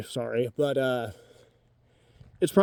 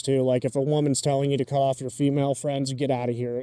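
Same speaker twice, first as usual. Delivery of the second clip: ordinary delivery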